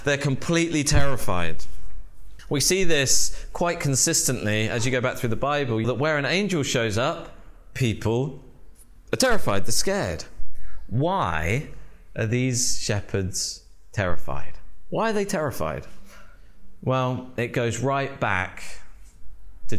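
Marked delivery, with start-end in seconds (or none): none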